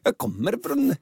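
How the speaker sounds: high, halting attempt at a Norwegian accent; Norwegian impression. Shown as a demonstration. Co-host asked how Norwegian sounds, faltering Norwegian impression. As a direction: try a sing-song Norwegian, then falter. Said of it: "Och hur låter norska?".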